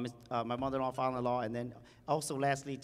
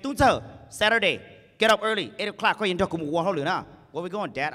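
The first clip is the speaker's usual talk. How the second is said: dad's command; 8 dB louder than usual. Retold or imitated. Father ordering son, retold.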